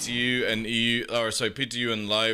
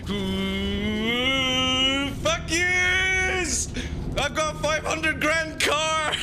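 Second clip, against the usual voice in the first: high mocking gloat, much higher pitch; gloating show-off driver; 13 semitones higher, much slower than usual. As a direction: taunt the traffic from your flying car. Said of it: "And you're just like".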